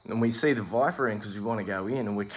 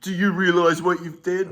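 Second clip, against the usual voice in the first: higher, louder, incredulous; mock exasperated friend; 6 semitones higher, 5 dB louder than usual. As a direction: scold your dim friend. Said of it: "and he goes".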